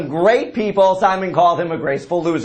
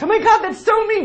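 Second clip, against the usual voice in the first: ordinary talk, nothing marked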